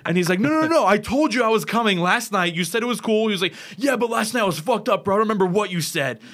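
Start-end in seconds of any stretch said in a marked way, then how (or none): 0.4-3.3 higher, louder, insistent pleading; retold protest. Retelling the fighter reminding his friend of a promise, insistent protest. 3.8-6.2 higher-pitched mock irate comeback; retold anger. Retelling the friend disowning last night's promise, imitated irritation. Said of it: "he just got really mad".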